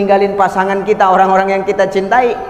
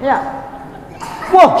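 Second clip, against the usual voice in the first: ordinary talk, nothing marked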